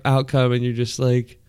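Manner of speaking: ordinary talk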